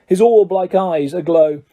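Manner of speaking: usual speaking voice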